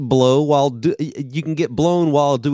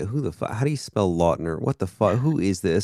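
baffled muttering to self; 7 semitones lower, 5 dB quieter than usual. Retold or imitated. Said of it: "but I'm just like".

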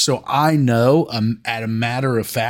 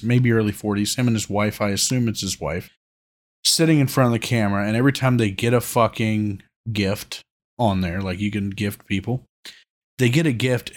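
usual speaking voice

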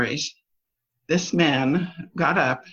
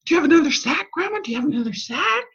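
higher, eager, quickening plea; eager kids; 11 semitones higher, much faster than usual. Retold or imitated. Grandkids begging grandma for another sack, eager higher voice. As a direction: kids begging for more, speeding up. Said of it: "And they were like".

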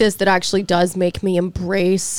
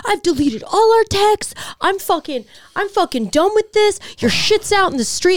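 angry yelling on the phone; 12 semitones higher than usual. Retold or imitated. Neighbor yelling his breakup into the phone. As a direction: scream it like a jilted boyfriend. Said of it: "And he's like".

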